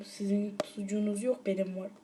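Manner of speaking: soft teasing whisper. Real, not acted. Teasing viewers in a soft whisper about his sausage. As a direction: whisper it like a secret brag.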